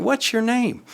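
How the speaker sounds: put-on high, light voice for a little kid; talking to a small child. Retelling how he asked the talkative preschooler his name, high and gentle. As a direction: coax a preschooler to answer.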